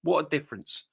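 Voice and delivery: normal talking voice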